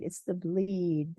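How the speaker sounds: "It's the bleed" slowed right down, each word weighted; slow emphasis. Shown as a demonstration. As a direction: drag out "It's the bleed" to drive it home.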